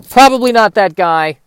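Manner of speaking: ordinary delivery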